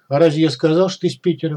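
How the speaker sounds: usual speaking voice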